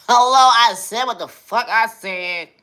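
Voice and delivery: hammed-up high-pitched sass; mock diva outburst. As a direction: burst out in a high cartoonish voice. Sudden outburst in put-on voice.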